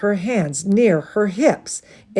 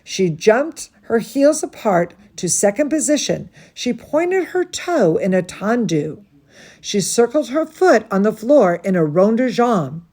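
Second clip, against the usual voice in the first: plain reading voice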